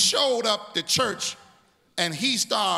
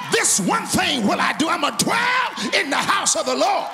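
shouted psalm recitation; 3 semitones higher than usual. Acted. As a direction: chant the psalm, climbing to a shout.

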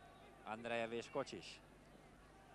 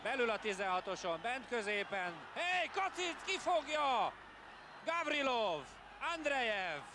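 excited commentary; 8 semitones higher than usual. Real, not acted. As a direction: call the save at full pitch. Chance, save.

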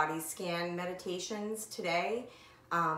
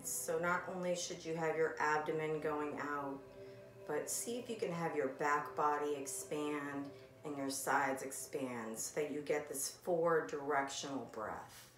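calm, softened meditation guide voice; gentle, unhurried breathing instructions with long pauses; guided meditation; 9 dB quieter than usual. Acted. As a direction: ease them into the breath, leaving long silences. Leads a body scan meditation.

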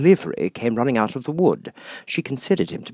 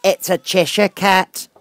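Duchess; 11 semitones higher, 6 dB louder, slower than usual. Acted. Duchess character voice.

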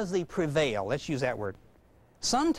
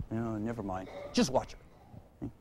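curt aside; 10 semitones lower, 8 dB quieter than usual. Acted. Mother hands child to Dad.

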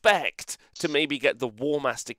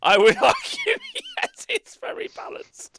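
squeaky, high voice breaking into laughter; laughing defence; 3 dB louder, slower than usual. Real, not acted. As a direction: argue it through helpless laughter, voice shooting up. Jokingly defending an overpowered character.

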